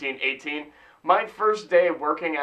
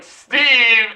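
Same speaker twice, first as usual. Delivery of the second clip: loud, higher, drawn-out name; pissed-off call; 6 semitones higher, 8 dB louder than usual. Retold or imitated. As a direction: call him out by name, mock angry. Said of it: "had pissed off".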